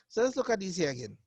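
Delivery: ordinary delivery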